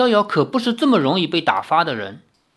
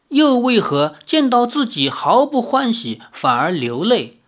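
ordinary delivery, nothing marked

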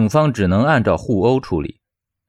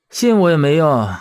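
higher-pitched, dismissive resignation; resigned indifference; 6 semitones higher than usual. Acted. Fired teacher answering his outraged friend, resigned. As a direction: shrug it off helplessly. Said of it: "表情淡然的道".

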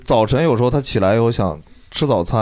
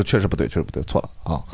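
quick apology; 7 semitones lower, 6 dB quieter than usual. Retold or imitated. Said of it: "我说".

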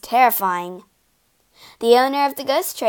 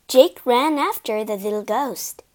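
ordinary delivery, nothing marked

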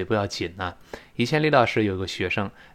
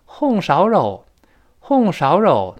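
"红烧肉" with Henan tones, higher and louder; Henan dialect; 7 semitones higher, 6 dB louder, slower than usual. Shown as a demonstration. Teacher imitating Henan dialect. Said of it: "河南话红烧肉".

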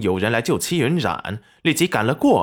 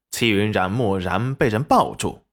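ordinary delivery

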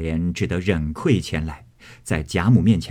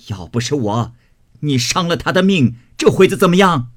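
much louder, higher furious grandmother; spitting scolding; 6 semitones higher, 5 dB louder than usual. A narrator's reading. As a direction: spit out contempt and rage at him. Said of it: "贾母啐道".

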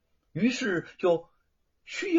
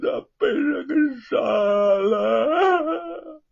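high, loud sobbing cry, slowing to a wail; earth-shaking sobbing; 13 semitones higher, 7 dB louder, slower than usual. A narrator's reading. Son reports his father's murder to the prefect, sobbing loudly. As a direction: sob it out at the top of the voice. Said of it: "哭的是惊天动地".

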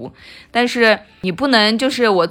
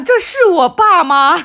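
shocked disbelief; 9 semitones higher, 6 dB louder, slower than usual. Retold or imitated. Shocked by dad's rare praise.

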